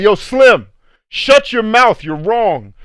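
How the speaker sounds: loud, high re-enacted telling-off; angry confrontation. Coach confronting a parent yelling at kids, angry retold shout.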